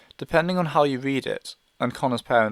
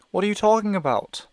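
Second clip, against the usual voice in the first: sudden urgent lift, higher and louder; new energy; 8 semitones higher, 4 dB louder than usual. Acted. Mother presses Evan with new urgency, higher and louder. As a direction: she seizes on a hope. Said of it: "energy in her voice".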